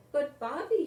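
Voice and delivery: mother pleading. A narrator's reading. Mother urging Bobby before kindergarten. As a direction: gently push him to make friends. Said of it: "his mother said".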